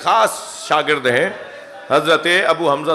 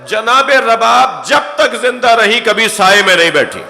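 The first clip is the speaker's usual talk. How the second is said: raised lament; 7 semitones higher, 4 dB louder than usual. Acted. Climactic mourning line.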